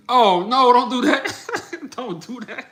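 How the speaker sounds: real laughing don't; laughing protest. Real, not acted. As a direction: beg them to stop through the laugh. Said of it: "laughing at chat nickname".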